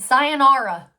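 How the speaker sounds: Sayonara called out loud with a falling finish. Retold or imitated. Saying goodbye to her brother, loud sayonara. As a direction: belt out Sayonara as a parting shot. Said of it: "I said".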